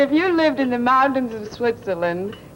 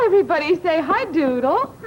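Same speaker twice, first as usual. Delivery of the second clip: put-on cheerful call to kids; 8 semitones higher, faster than usual. Prompting children to greet puppet.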